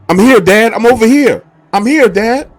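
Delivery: loud, high calling back to dad. Retold or imitated. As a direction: yell to be found across the marsh. Lost boy calling to father.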